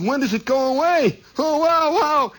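high, mock-agonized wail; pained wail. Injured player asks trainer when it goes away, wailing in pain. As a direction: wail the question, then moan on up high.

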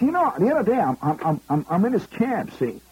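high, brash Bronx New York voice; Fordham Road Bronx accent. Retold or imitated. Tipsy executive boasting to comic, in Bronx accent. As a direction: drop the English polish, go full Fordham Road. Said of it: "he's talking like he's from Fordham Road".

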